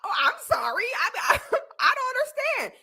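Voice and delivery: bewildered apology breaking into laughs; laughing mock apology. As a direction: giggle through a pretend-helpless sorry.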